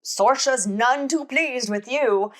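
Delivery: lightly voiced ominous old lady warning. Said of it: "the old lady saying".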